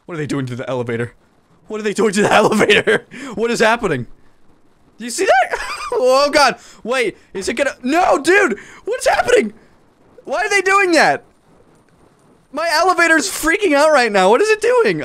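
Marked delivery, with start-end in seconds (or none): 1.7-6.6 high, laughing through the words; laughing disbelief. Real, not acted. Gamer watching his elevator glitch, laughing. 6.8-15.0 high, louder frantic questions; alarmed at the broken elevator; frantic disbelief. Real, not acted. Gamer asking why his elevator freaks out, frantic.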